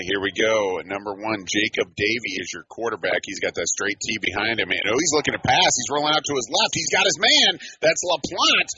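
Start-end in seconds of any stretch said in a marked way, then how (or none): 4.7-8.7 excited football call, pitched up; raised-voice sportscaster following the action; live play-by-play. Real, not acted. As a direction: call the pass as it unfolds, rising into the catch.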